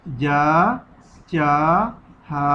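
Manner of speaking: usual speaking voice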